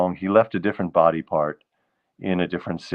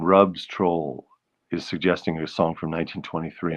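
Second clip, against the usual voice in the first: ordinary delivery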